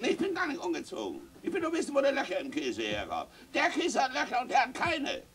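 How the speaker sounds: put-on high, quick protesting boy; lightly voiced child insisting; child's voice. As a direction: protest like a boy who feels wronged. Child answers father.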